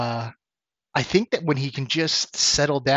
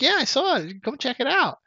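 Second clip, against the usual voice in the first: much higher mock friend voice; Chris; 12 semitones higher than usual. Friend urging roommate to see a movie, high mock voice. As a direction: imitate a pushy friend, pitched way up. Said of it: "Chris Kavanaugh actually sounded like this".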